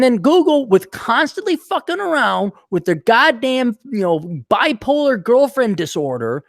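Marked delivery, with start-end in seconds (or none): none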